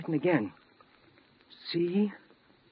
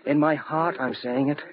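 ordinary talk, nothing marked

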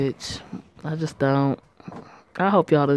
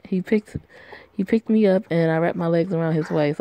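ordinary delivery

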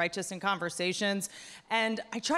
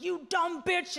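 mock yell; 8 semitones higher, slower than usual. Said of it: "He's like".

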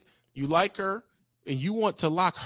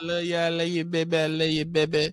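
slurred mushy mock pleading; mock sweet-talker; 2 semitones higher than usual. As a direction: lightly mimic a lovesick man cooing. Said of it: "You're over here saying".

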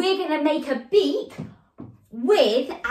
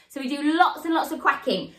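ordinary talk, nothing marked

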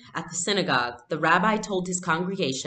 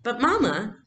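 put-on higher, louder girl protesting; in dismay; 7 semitones higher, 4 dB louder than usual. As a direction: plead with dismay. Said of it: "Anne-Marie said in dismay".

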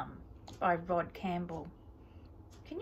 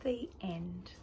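whispering; 3 semitones lower, 7 dB quieter than usual. Real, not acted. Whispered story ending.

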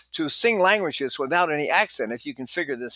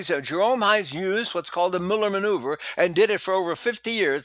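usual speaking voice

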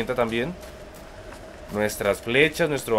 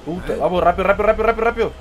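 urgent; 7 semitones higher, 3 dB louder, much faster than usual. Real, not acted. Urgent moment in gameplay.

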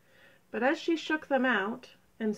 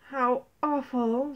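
dismayed; 4 semitones higher, slower than usual. A narrator's reading. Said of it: "she said, looking at them".

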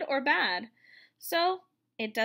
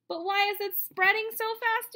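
put-on high, wondering child's question; a small child puzzling out loud; curious kid voice; 8 semitones higher, 5 dB louder than usual. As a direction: play a curious kid asking. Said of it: "you ask".

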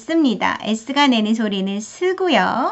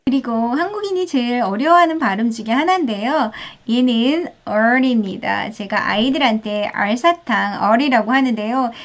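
normal talking voice

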